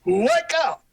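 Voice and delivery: shouting into the mic; loud call. Real, not acted. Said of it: "Yeah, that's a little louder".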